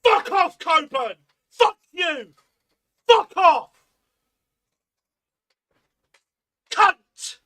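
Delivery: screamed, raging insults, high and loud; real rage, yelled hard; furious shouting. Real, not acted. Fan screaming at Coburn after the miss, furious shout.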